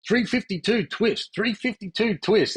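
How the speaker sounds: teammates mimicking an Aussie accent, high and slow; American players teasing the Aussie with an over-the-top Aussie accent; mock Aussie accent. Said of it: "the Aussie accent".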